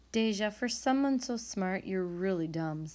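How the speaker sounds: ordinary delivery, nothing marked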